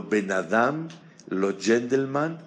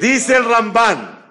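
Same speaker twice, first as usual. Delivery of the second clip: excited announcement; 12 semitones higher, 9 dB louder, slower than usual. Real, not acted. Teacher introduces commentator.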